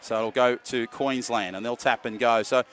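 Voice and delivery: brisk, lively match call; live play-by-play. Real, not acted. Commentator calling the match live, brisk play-by-play. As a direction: keep the call moving with the play.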